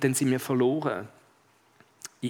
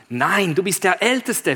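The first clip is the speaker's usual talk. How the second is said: ordinary delivery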